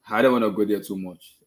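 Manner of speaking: ordinary delivery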